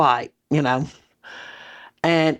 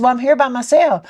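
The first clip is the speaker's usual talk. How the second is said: husband objecting; 7 semitones higher, 5 dB louder than usual. Retold or imitated. Voicing husband's objection on phone.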